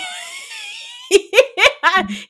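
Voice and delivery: high, loud shrieking laugh; cracking up helplessly; burst of laughter. Real, not acted. Sister laughing at the kidnapper joke, loud shrieking laugh.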